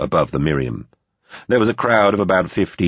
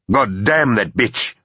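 slow, higher furious outburst; a hammed-up slow curse, pitched up; angry curse; 7 semitones higher, slower than usual. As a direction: spit the curse out in rage. Kendrick curses, clutching the rail.

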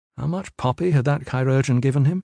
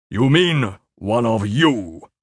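slow, menacing correction; low and dangerous; 4 dB louder, slower than usual. A narrator's reading. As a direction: draw it out slowly as a threat. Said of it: "low and dangerous".

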